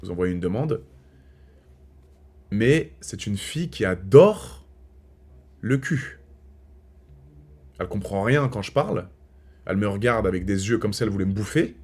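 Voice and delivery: a soft, low confiding aside to viewers; hushed aside. Real, not acted. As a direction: lean in and tell them the secret quietly. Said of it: "quiet confiding to camera". (confidence chuchotée aux abonnés)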